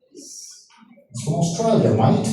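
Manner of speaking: put-on drawn-out joking Australian accent; playful, stretched Australian vowels; mock Australian accent. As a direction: say it slowly like a jokey Aussie. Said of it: "naiintindihan yung accent ng mga Australiano".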